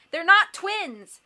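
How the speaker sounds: high, loud, slow correction; indignant cry. A narrator's reading. Violet corrects the coach about the triplets, crying out. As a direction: cry out, stretching the words. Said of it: "Violet cried".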